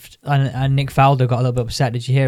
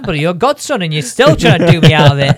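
mock outrage; 3 semitones higher, 5 dB louder than usual. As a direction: play up the betrayal with a laugh. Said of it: "I'm thinking".

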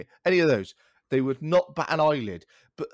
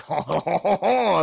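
hammed-up French "ha ha", rising high and loud; mock French laugh; 4 semitones higher, 6 dB louder, slower than usual. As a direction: laugh like a caricature of French speech. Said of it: "a French accent".

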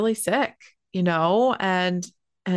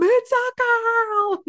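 very high, slow, drawn-out joyful cry; mom's tearful joy; 4 dB louder, much slower than usual. Retold or imitated. Mom crying out over the newborn on the video, high drawn-out joyful wail. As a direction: imitate mom wailing with joy through tears. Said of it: "And you hear my mom".

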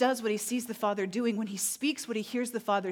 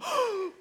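a sudden high gasp of shock; stunned intake of breath; sharp gasp; 14 semitones higher than usual. Retold or imitated. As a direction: gasp in astonishment. Both react to leg growing.

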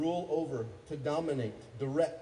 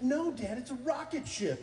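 higher-pitched imitation of a child; indignant little kid; 10 semitones higher than usual. Little son correcting Dad's guess, mock indignant. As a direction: correct Dad like an exasperated kid. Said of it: "He's like".

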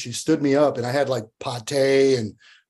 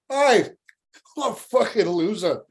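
higher-pitched mock Scottish voice; Scottish accent; 7 semitones higher than usual. Buddy mocks his stood-up friend, high Scottish accent. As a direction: imitate a Scottish buddy taking the mickey. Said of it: "in the Scottish accent".

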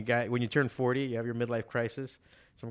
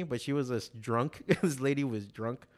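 normal talking voice

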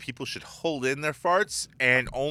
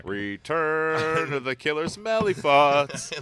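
slow, drawn-out title read; mock movie-title announcement; slower than usual. Host announces the returning smell, as a drawn-out mock title. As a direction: announce it like a cheesy horror title.

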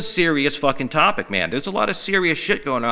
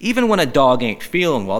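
normal talking voice

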